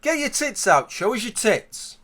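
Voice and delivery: high-pitched catcall across a road; acted shout. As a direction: call it out high, drawing out the end. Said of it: "I shout over the road".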